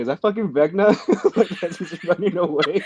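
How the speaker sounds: half-laughing shock at the scene; laughing disbelief. Retold or imitated. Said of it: "And I was like".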